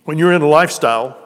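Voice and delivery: preacher raising his voice; emphatic. Real, not acted. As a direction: drive the point home to the crowd. Preacher hammering his point.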